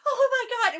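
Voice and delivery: loud high relieved cry. Retold or imitated. Aunt's reaction, retold.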